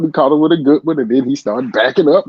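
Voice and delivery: ordinary delivery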